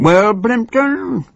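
a lightly voiced snarl, high on Plimpton; snarling Chief Whip. Chief Whip pressing Plimpton, a lightly voiced snarl. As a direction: needle him with a mock snarl. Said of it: "He scowled and snarled".

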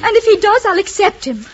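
loud, high declaration; defiant. Acted. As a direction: cut in and stand up to him. Defies uncle in quarrel.

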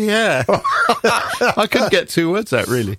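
slow, high words through laughter; laughing while talking. Real, not acted. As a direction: squeeze the words out between laughs.

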